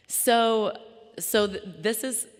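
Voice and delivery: fighting back tears mid-sentence; choked up. Real, not acted. Speaker moved before her audience, voice catching and breaking. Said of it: "I don't know why I'm getting emotional".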